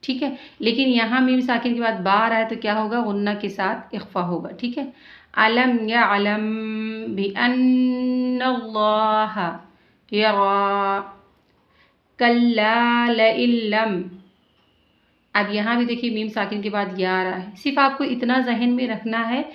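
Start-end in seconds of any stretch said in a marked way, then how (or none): 5.4-14.0 slow, drawn-out tajweed recitation; measured Quranic recitation. Shown as a demonstration. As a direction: chant each word slowly, stretching the vowels. Teacher recites Quran verses.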